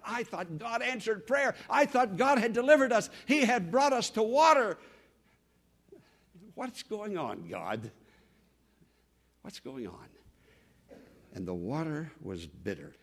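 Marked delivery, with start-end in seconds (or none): 0.1-4.8 high, loud cry of complaint; let-down protest. Acted. Let-down people who expected drinkable water, anguished protest. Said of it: "What a letdown". 6.6-10.1 dejected questioning. Acted. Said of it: "What a letdown".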